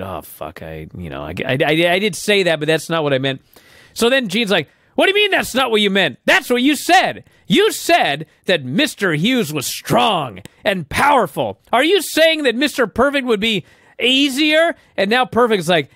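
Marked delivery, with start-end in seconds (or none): 0.0-3.4 nervous mumbling. Retold or imitated. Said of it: "starts mumbling ... acting all nervous". 4.9-14.7 high-pitched, indignant interviewer grilling; mock accusing. Retold interviewer pressing Bret.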